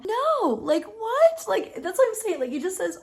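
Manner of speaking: high, loud disbelief; incredulous. Real, not acted. Reacting to Sam's words, high and loud incredulous. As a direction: squeal in disbelief.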